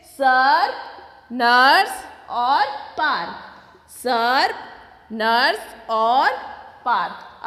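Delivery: each word slow and clear, for the class to repeat; drawn out so children can echo; slow choral drill. Shown as a demonstration. Teacher leads Class One in repeating सर, नर्स, पार्क slowly. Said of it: "सब मेरे साथ उच्चारण करेंगे फिर से".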